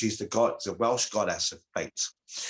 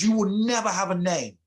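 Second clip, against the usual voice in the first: high, loud furious declaration; angry curse; 11 semitones higher, 6 dB louder than usual. Acted. Goddess cursing her son, high and loud in fury. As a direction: hurl it at the boy like a curse. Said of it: "she's so angry at Gwydion's deception".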